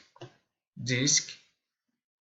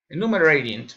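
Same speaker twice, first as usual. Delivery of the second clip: usual speaking voice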